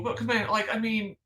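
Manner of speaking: high-pitched, incredulous protest; baffled, voice climbing high in protest; exasperated disbelief. Real, not acted. Exasperated at opponents' hypocrisy.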